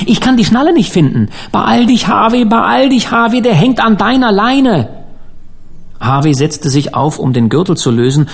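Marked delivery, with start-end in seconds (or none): 0.1-1.3 fast, high-pitched panic; crying out. A narrator's reading. Harvey fumbling at the belt buckle, crying out. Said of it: "rief Harvey". 1.5-4.9 high, insistent panic; frantic urging. A narrator's reading. Dan to Harvey as the corpse hangs there, frantic urging.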